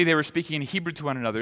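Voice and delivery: ordinary talk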